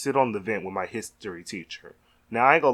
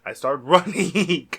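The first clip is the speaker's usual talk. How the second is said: laughing; 5 semitones higher, 5 dB louder, slower than usual. Real, not acted. Laughing while retelling escape.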